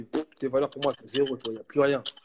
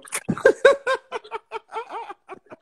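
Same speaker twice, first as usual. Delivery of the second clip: laughing; 5 dB louder than usual. Real, not acted. Laughs after threatening the club.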